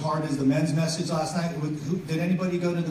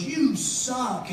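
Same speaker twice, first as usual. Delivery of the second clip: heckler's taunt; 7 semitones higher than usual. Retold or imitated. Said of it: "And Billy looks and he goes".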